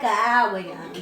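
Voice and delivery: plain reading voice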